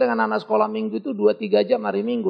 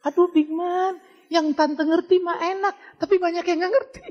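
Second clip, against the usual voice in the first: complaining auntie; 11 semitones higher, 3 dB louder than usual. Retold or imitated. Older aunties complain they can't follow him, high pleading auntie voice. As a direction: play an older church lady pleading with the preacher. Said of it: "apalagi tante-tante".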